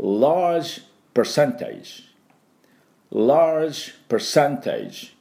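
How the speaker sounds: large percentage said very slowly for repeating; drawn-out large percentage for students; repeat-after-me model; slow model English. Shown as a demonstration. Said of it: "私の後について発音いきましょう".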